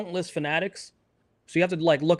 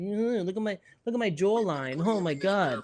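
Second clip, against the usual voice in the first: vain guy; 2 semitones higher, 4 dB quieter than usual. Retold or imitated. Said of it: "He thinks he's so pretty".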